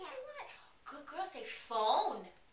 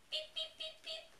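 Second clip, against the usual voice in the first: high chirpy beep beep beep, like a toy phone; playful phone beeps; 14 semitones higher than usual. Pretend. Playing phone with the toddler, high toy beeps.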